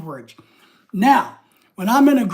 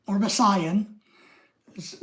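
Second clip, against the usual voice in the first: usual speaking voice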